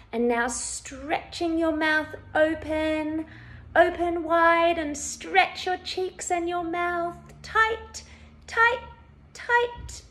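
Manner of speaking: high, loud, building effort; urging to stretch. Shown as a demonstration. Instructor urging listeners to stretch mouths wide, urging to stretch.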